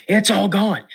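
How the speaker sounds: It's all gone cried out high and urgent; boy shouting to his dad that It's all gone, high and excited; upset kid. Retold or imitated. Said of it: "and so I'm like".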